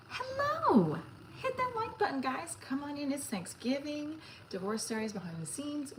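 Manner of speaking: high cheerful greeting. Real, not acted. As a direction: pitch way up to welcome viewers. Greeting viewers, much higher.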